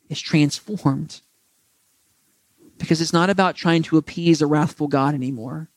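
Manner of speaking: earnest closing prayer to the Father; preacher praying over his listeners. Real, not acted. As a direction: pray sincerely, unhurried.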